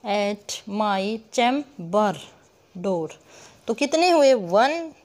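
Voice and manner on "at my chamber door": very slow verse with gaps between syllables; syllable-by-syllable scansion. Shown as a demonstration. Teacher reads the line's end, slowly syllable by syllable. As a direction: break chamber into cham, ber, slowly. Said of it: "जितने हम टुकड़ों में pronounce करेंगे".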